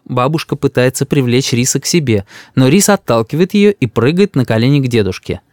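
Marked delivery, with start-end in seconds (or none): none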